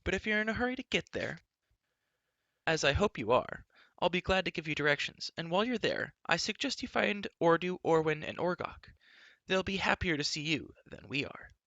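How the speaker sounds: put-on spirited girl sending a rival packing; princess's voice. A narrator's reading. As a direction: pile on the mockery. Elenwy's voice.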